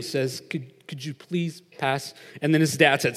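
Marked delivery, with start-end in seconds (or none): 0.5-2.1 soft, slow, hesitant plea; really quiet. Retold or imitated. Timid Benson asks his dad a second time, quietly. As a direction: ask timidly, stumbling on the first word. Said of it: "again, the same thing".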